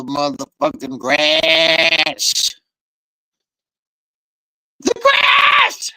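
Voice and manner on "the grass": high, very loud shout at the lawn. Real, not acted. Yelling about the grass too loud to the camera. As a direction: belt out the grass like a war cry. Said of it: "I said that too loud".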